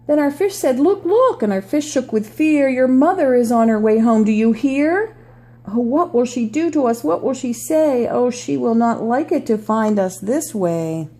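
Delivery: storybook read-aloud voice; reading to children. Acted. Reads picture book aloud.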